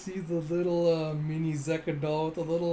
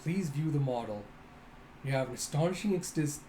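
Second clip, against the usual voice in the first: plain reading voice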